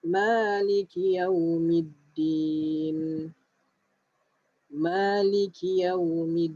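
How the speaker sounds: slow, drawn-out tajwid recitation; slow Quran recitation. Shown as a demonstration. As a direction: recite it slowly for students to follow. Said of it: "Ayat nombor empat". (bacaan tajwid perlahan)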